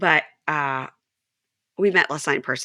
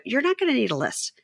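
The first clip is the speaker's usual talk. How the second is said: retorting; 7 semitones higher than usual. Retold or imitated. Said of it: "I go".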